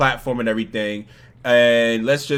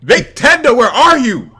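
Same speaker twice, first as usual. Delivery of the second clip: high, loud call; 10 semitones higher, 8 dB louder, slower than usual. Real, not acted. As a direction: call out for someone far away. Calling out for absent Nick.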